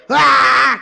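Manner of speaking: put-on high, very loud toddler shriek with a laugh; baby scream. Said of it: "empieza a gritar". (grito agudo y fortísimo de bebé)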